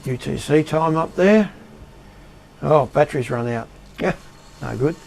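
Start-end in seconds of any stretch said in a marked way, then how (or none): none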